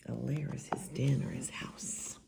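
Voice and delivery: quick whispered aside; whispering. Real, not acted. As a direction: explain it in a soft whisper. Whispered explanation.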